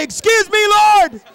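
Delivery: high, loud, slow shouted prayer; fervent drawn-out cry; shouted plea. Shown as a demonstration. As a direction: call it out to the Lord. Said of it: "lean in and say".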